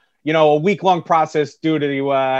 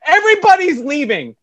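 high, slow, hammed-up yell; yelling back; 10 semitones higher, 5 dB louder than usual. Drunk birthday host yelling back at Spectrum's agent. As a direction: wail it as an aggrieved party host. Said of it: "yelling back at this woman".

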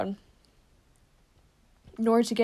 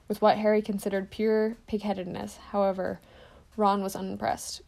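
ordinary delivery, nothing marked

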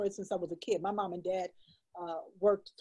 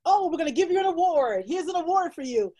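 higher, louder mock announcement; imitating award givers; 8 semitones higher, 5 dB louder than usual. Illustrator mocking the award she hoped for, higher and louder. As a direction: hand out an imagined award grandly. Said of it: "recognize the work and go".

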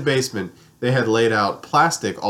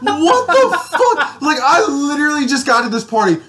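laughing disbelief; 12 semitones higher, 5 dB louder than usual. Real, not acted. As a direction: crack up while retelling the shock. His shocked reaction, laughing.

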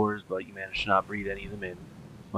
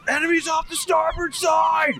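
shouted warning; 6 dB louder than usual. Acted. Lookout shout.

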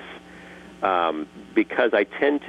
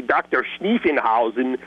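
higher German-accented Doctor Schniefenhausen; 3 semitones higher than usual. Shown as a demonstration. Voice actor demoing a German accent on one character. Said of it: "then you make him German".

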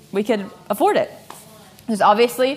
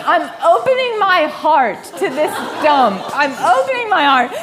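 higher, louder, laughing through it; laughing mock devotion; 6 semitones higher, 4 dB louder than usual. To Jake outside the ugly house, laughing mock devotion. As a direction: ham up the pious resolve while cracking up. Herself quoted resolving to accept.

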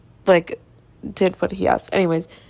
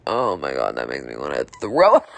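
exaggerated deep voice. Real, not acted. Disgust at her own compliance.

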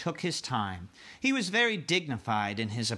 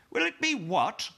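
queen voice; 9 semitones higher than usual. Acted. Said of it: "the Queen said".

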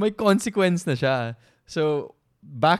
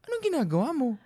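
higher, teasing bewilderment; mock confused; 11 semitones higher, much faster than usual. Fiancé pretending confusion at the proposal, high and teasing. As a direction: act baffled on purpose.

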